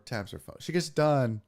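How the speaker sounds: normal talking voice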